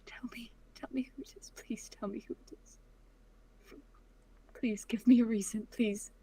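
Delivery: desperate, barely voiced begging; whispered pleading. Daughter begging her father for a name in a whisper.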